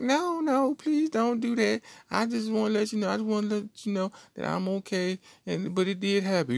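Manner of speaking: mock pleading girl. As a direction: beg him not to, in a squeaky girly voice.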